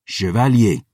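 sharp, short rebuke; crisp, irritated reprimand; snapped. A narrator's reading. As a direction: snap the title as a warning. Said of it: "the historian snapped".

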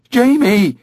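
loud high cry of a name; shout. Acted. Mother searching the garden shouts for her son, loud cry. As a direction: call out across the garden for the lost boy. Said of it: "Alex started to shout".

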